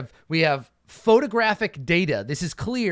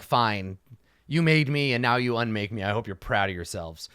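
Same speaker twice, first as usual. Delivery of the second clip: bitter resignation; 6 semitones lower than usual. Retold or imitated. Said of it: "Acceptance of death".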